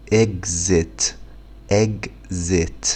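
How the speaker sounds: إكزيت said slowly, eg-zet; slow spelled-out exit. Shown as a demonstration. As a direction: draw out إكزيت sound by sound. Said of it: "تاني". (نطق بطيء لكلمة إكزيت)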